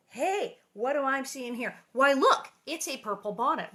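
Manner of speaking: playful feigned discovery, jumping up on "look"; mock surprise. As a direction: pretend you've just spotted it. Staged discovery of her own bonnet.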